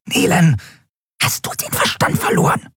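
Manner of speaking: sharp hissed whisper. A narrator's reading. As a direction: hiss it at her friend. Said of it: "zischte Nelen".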